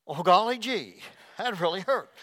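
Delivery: loud, high gee-whiz voice; squeaky-clean golly-gee exclamation; wholesome 1950s kid. Retold or imitated.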